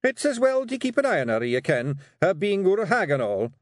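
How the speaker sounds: high, nervous Scottish excuse; trailing away. Acted. Rob under his wife's look, excuse petering out. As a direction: offer the excuse with failing confidence. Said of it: "Rob Anybody's voice trailed away".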